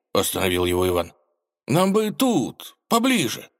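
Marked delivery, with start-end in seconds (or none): 1.7-3.5 high, slow pleading voice. Acted. Ivan asks pleadingly.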